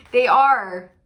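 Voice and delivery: loud insistence; emphatic. Real, not acted. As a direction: hammer it home.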